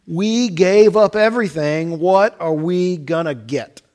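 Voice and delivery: loud, slowing demand; disciples' demand. Acted. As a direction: shout out what we get. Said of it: "the question".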